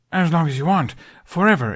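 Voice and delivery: nervous blurt. A narrator's reading. Hiccup overeager asking Jack to stay, blurting. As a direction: let it tumble out too eagerly.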